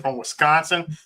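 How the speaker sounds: ordinary talk, nothing marked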